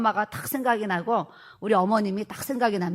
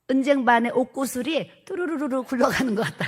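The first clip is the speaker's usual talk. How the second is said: mother's praise; 4 semitones higher than usual. Retold or imitated. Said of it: "그랬어요".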